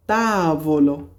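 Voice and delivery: তাবলো stretched slowly, long first vowel; drawn-out demonstration. Shown as a demonstration. Teacher models তাবলো for Italian learners, drawn-out demonstration. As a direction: draw out the first syllable of তাবলো.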